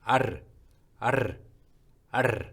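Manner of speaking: 아르 with a short tapped trilled r; 아르 said with a quick rolled r. Shown as a demonstration. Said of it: "이렇게 연습을 해보세요".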